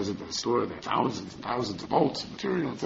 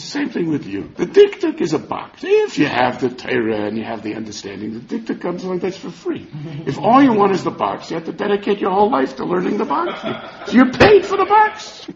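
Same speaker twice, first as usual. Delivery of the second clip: excited punchline; 7 semitones higher, 6 dB louder than usual. Acted. Husband delivers the punchline.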